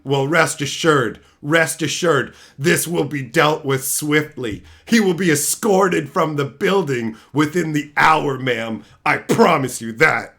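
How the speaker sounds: low, loud pompous manager voice; fake manager. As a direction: pose as the manager, puffed up. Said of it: "I'm the manager here".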